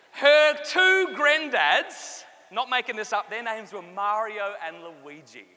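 ordinary talk

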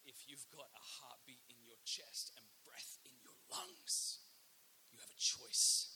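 slow urgent whisper; intense whisper. Real, not acted. Preacher urging the audience that they have a choice, urgent whisper. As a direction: press the point home in a hush. Sermon's emphatic hushed appeal.

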